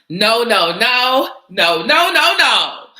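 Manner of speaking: put-on loud, high-pitched African accent on the no's, easing off; playful African accent, starting loud and high. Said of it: "when I do the African accent".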